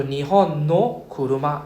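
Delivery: teacher spells out the phrase for students, slowly; slow careful phrase. Shown as a demonstration.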